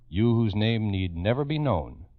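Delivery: usual speaking voice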